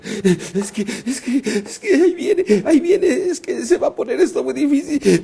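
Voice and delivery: hammed-up panicky stammer, high and jittery; mock terror, gasping out repeated phrases high; trembling with fear. Preacher imitating someone shaking with fear as others calm them, panicky. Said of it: "tú estás temblando".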